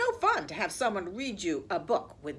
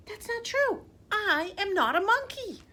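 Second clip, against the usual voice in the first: indignant; 10 semitones higher, 4 dB louder than usual. Acted. Reader protests the monkey line.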